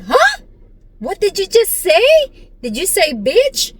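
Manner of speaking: high-pitched shocked American woman. Retold or imitated. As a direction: imitate a stunned, disbelieving listener. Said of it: "na-shock siya".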